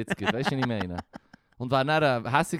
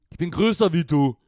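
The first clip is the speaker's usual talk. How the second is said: dopey voice; 2 semitones higher, 6 dB louder than usual. Shown as a demonstration. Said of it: "mit einer dümmlichen Stimme".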